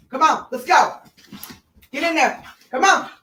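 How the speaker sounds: high, bright calling to the dogs; coaxing call. Real, not acted. Owner calling her puppies into the playpen, high-pitched. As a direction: lift the voice to call them in.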